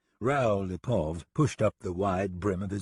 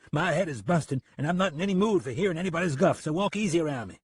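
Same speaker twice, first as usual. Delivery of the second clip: irritated, high-pitched grumbling; fast, cross warning; 8 semitones higher, faster than usual. Acted. Deputy with a busted head warns off the gunman, cross. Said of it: "Price said crossly".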